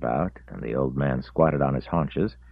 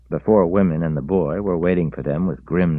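ordinary delivery

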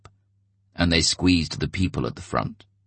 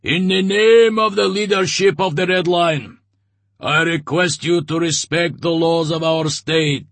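much higher, louder commanding proclamation; booming official address; 12 semitones higher, 6 dB louder than usual. A narrator's reading. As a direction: proclaim it like a state order. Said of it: "addressed the people in a booming voice".

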